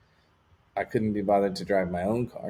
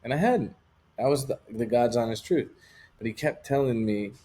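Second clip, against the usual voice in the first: normal talking voice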